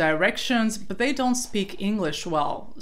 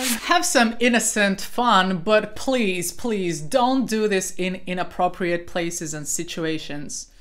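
ordinary talk

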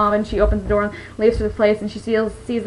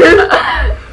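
laughing; 6 semitones higher, 7 dB louder than usual. Real, not acted. Laughs at friend's remark.

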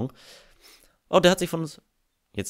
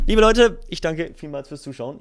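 outro greeting; 5 semitones higher, 6 dB louder than usual. Real, not acted. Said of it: "outro greeting to viewers".

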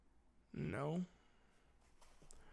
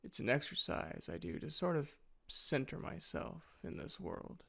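usual speaking voice

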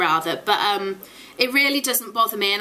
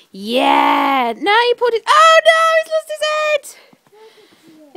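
loud, high excited yells; excited shouting; 13 semitones higher, 9 dB louder, much slower than usual. Real, not acted. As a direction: cheer and shout at the snow duck. Kids kicking snow duck, excited shouting.

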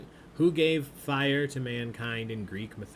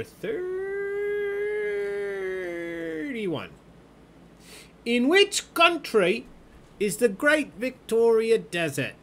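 high, stretched Aussie voice, drawn out; Australian accent; 13 semitones higher, 3 dB louder, much slower than usual. Acted. Trivia host reads the last question in an Aussie accent. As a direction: put on a hammed-up Australian accent. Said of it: "with my awesome accents".

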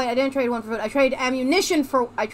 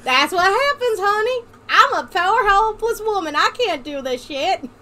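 put-on Southern accent; 6 semitones higher, 3 dB louder than usual. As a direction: play a helpless wife in a hammed-up Southern accent. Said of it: "your accent is starting to bother me".

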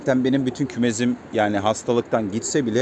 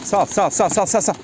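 urging the birds; 5 semitones higher than usual. Real, not acted.

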